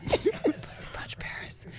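voiceless, very soft whisper; retold whisper. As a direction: whisper it like a secret chant. Repeats the whispered phrase after laughing.

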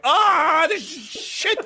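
high, loud frustrated groan; exasperated outburst. Retold or imitated. Dad vents loudly at the mess, high pitched. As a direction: spit it out in disgust. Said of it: "I'm like".

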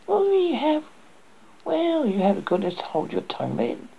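high, haughty, dismissive cat; snooty bored cat. Acted. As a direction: sneer it at the duckling. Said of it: "the cat said".

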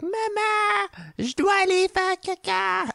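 put-on very high little kid voice in French; child voice. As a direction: squeak it like a small child. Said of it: "waiting for a child to go like".

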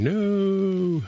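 high, drawn-out mock cry of no; mock scream. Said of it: "starts screaming".

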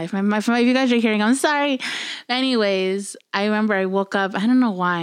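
ordinary talk

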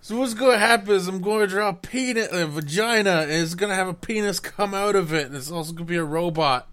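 high, loud and fast giddy rant; gleeful fast build-up; giddy excitement. Real, not acted. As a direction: rattle it off in giddy glee. Silly hyped plan.

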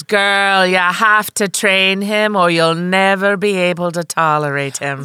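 slow, emphatic imitation of a Jamaican cabbie; Jamaican cabbie accent. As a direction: warn her in a rough Jamaican accent. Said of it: "she was Jamaican".

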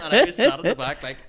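ordinary delivery

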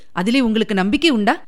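usual speaking voice

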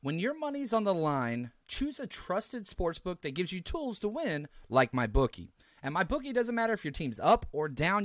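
sponsor ad read. Acted. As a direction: read the sponsor spot smoothly.

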